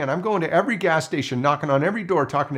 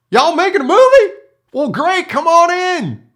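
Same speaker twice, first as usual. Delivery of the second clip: much higher and louder, hillbilly welcome; sudden delight; 12 dB louder than usual. Retold or imitated. Recluse learning it's a movie, suddenly delighted welcome. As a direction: flip from menace to thrilled host. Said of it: "He goes".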